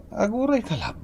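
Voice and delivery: high startled wait; Ricky suddenly startled while realizing, high-pitched. Acted. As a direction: catch yourself in surprise.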